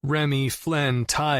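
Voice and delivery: plain reading voice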